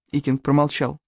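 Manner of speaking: plain reading voice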